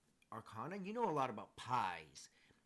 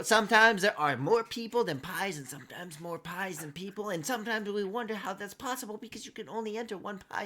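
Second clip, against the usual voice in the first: rambling halfling; 5 semitones higher, 9 dB louder than usual. Acted. Halfling rambles, spirals, recounts.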